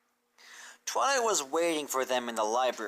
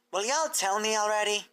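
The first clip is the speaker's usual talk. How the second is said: higher, impatient filly; an exasperated little filly demanding answers; Apple Bloom's voice; 8 semitones higher than usual. Acted.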